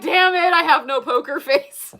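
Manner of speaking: high, loud laughing exasperation; hammed-up self-annoyance; mock frustrated. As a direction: scold yourself, half-laughing. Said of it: "I'm like".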